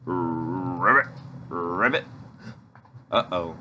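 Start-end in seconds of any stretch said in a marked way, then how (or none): 0.1-2.1 low croaking ribbit; frog croak. Acted. Leader voicing the giant hungry frog, low croak.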